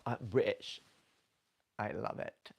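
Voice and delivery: loud mock-British accent. Shown as a demonstration. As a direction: imitate British, hitting "British" loudly. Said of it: "I love the, in, the British".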